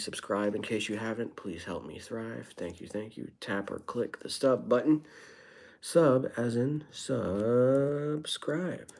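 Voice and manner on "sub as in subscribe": "Sub" stretched very long and higher; drawn-out "Sub". Real, not acted. Streamer telling viewers which button to tap, "Sub" drawn out. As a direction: draw out the first syllable of "subscribe" slowly.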